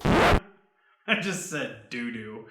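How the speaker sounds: giggling through a slip; laughing at self. Real, not acted. Host cracking up at his slip, laughing. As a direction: break up laughing at your own word.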